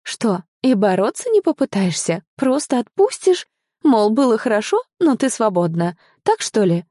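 high-pitched, hurt indignation; indignant challenge. Acted. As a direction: fire accusing questions at them. Hurt, challenging partner in quarrel.

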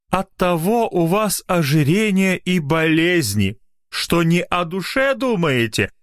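high, slow lecturing tone; preachy. Acted. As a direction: moralize at them from above. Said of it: "назидательно произнёс он". (назидательно)

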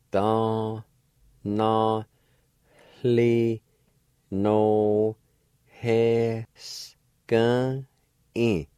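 Da, na, li, no, he, skan, E sounded out one at a time; syllable by syllable. Shown as a demonstration. Teacher sounds out a Cherokee word for learners, syllable by syllable. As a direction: spell out Da, na, li, no, he, skan, E with a faint H. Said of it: "like this".

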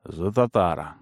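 put-on slow, slurred drunken toast; drunk rambling toast. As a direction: drag the words out drunk. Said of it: "заплетающимся языком".

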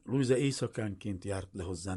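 plain reading voice

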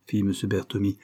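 usual speaking voice

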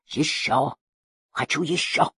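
lightly voiced monster's demand; frightening harsh voice. A narrator's reading. Monster's voice echoing through the cave, mildly menacing. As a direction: demand it like a dreadful creature, held back. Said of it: "громкий, страшный, резкий, эхом разносившийся".

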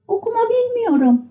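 very high-pitched little puppet voice; Pinokyo. A narrator's reading. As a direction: ask in a high childlike pitch. Pinokyo çocuğa cevap veriyor. (tiz kukla çocuk sesi)

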